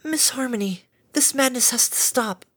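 high, pleading gentle mare voice; Fluttershy's voice. A narrator's reading. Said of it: "Fluttershy immediately shrieked".

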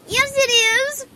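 put-on high, loud little-girl protest; indignant squeaky kid voice; squeaky arguing filly. A narrator's reading. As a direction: argue like a small child, squeaking upward. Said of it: "her voice squeaking at the last word".

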